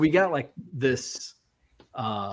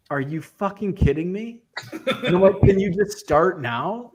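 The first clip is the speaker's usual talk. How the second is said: higher-pitched, incredulous retold complaint; exasperated disbelief; 6 semitones higher than usual. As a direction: replay your disbelief at the worker. Retells exasperated reaction to worker.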